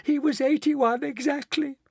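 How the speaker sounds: very high, distressed wail. A narrator's reading. Mrs Bucket to Mister Wonka, wailing. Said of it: "wailed Missus Bucket".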